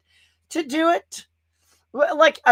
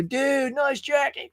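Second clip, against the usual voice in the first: higher-pitched imitation of an impressed stranger; admiring passer-by; 7 semitones higher, slower than usual. Streamer quoting people who spot his Halloween jacket, as admiring passers-by. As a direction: mimic someone complimenting you, pitched up. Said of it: "people go".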